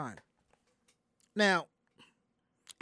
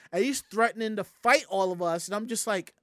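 normal talking voice

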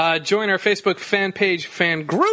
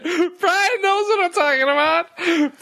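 laughing; 11 semitones higher than usual. Real, not acted. Laughing through repeated line.

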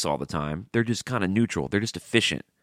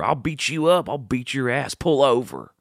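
higher-pitched, lightly acted challenge; mock road-rage threat; 5 semitones higher than usual. Mock threat to another driver to pull over, mildly heated. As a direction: play at picking a fight.

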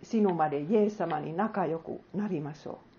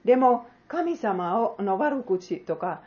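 normal talking voice

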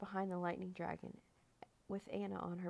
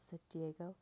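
ordinary delivery, nothing marked

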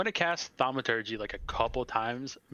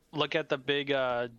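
normal talking voice

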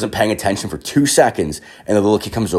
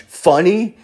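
high-pitched, rising taunt; taunting challenge; 11 semitones higher than usual. Retold or imitated. Drunk man confronting the laughing French family, taunting. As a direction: throw it at them as a high, provoking question.

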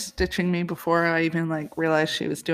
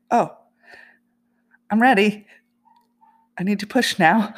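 a much louder, higher, urgent "I'm ready"; urgent retold plea; 4 semitones higher, 8 dB louder than usual. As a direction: relive the moment you knew you had to push. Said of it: "And I was like".

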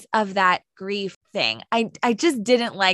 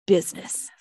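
curt whisper. Retold or imitated. Curt one-word answer on plane.

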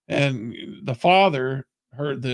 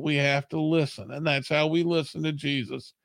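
ordinary talk

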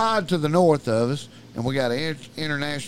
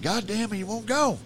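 exasperated complaint; 8 semitones higher than usual. Retold or imitated. Retold friend giving up on shooing.

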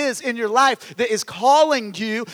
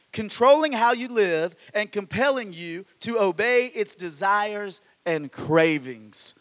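ordinary delivery

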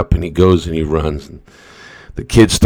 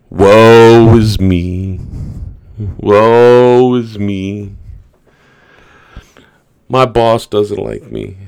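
mock self-pity; 3 semitones higher, 4 dB louder, much slower than usual. Mocking people who wallow.

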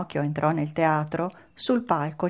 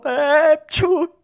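loud, high "ecciù" sneeze; big acted sneeze; 11 semitones higher, 8 dB louder than usual. A narrator's reading. Mangiafuoco starting to sneeze, loud high mock sneeze. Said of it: "si mise a starnutire".

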